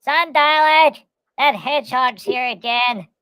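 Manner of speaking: high, loud, slow robotic Dalek. Retold or imitated. Papa Dalek announcing the hedgehog, high robotic. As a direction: grate each word like a Dalek. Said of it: "Papa Dalek says".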